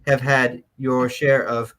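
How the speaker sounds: slow, measured fifties letter writer, British-sounding; nineteen-fifties voice. Acted. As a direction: draw it out like a mannered fifties reader. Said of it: "in your best nineteen fifties voice".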